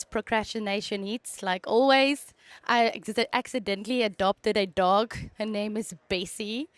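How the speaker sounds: ordinary talk, nothing marked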